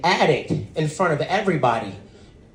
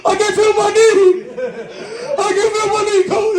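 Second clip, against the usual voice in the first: much higher, wailing injured player voice; Booby Miles impression; 14 semitones higher than usual. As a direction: sob to the coach like a hurt teen. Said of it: "Friday Night Lights and Booby Miles".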